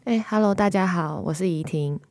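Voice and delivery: normal talking voice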